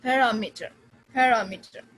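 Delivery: "parameter" with RA punched loud; loud RA, light falling end in "parameter"; third-last syllable stressed. Shown as a demonstration. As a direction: hit RA in "parameter", then drop away. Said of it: "stress on the third from the last".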